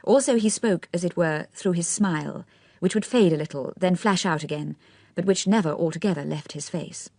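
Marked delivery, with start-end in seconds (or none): none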